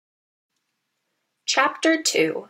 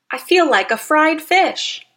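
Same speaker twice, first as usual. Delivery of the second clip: complaining; 5 semitones higher, 6 dB louder than usual. Acted. Overheated trout complaining.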